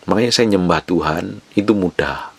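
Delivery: ordinary talk, nothing marked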